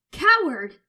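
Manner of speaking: very loud, high screech. Acted. Son screeching at his mother. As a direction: screech the insult at her. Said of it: "I screech".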